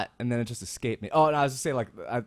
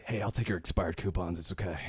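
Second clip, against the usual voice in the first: low, soft, shady offer; hushed, lower hustler voice; sketchy seller; 6 semitones lower, 8 dB quieter than usual. Acted. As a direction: murmur a dodgy deal. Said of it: "He just sounds sketchy".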